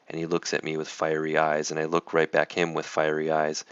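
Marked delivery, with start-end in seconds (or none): none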